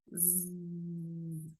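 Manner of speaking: long buzzing Z like a bee. Shown as a demonstration. Teacher imitating a bee for Spanish speakers, buzzing Z. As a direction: buzz Z like an insect. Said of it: "como un insecto, como una abeja".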